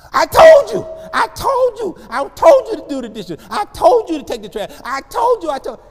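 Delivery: put-on screaming at the kids, high and loud; screaming parent. Parent screaming at kids about chores. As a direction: yell the same order over and over. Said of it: "you just gonna start screaming".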